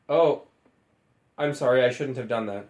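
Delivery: low David voice. Acted.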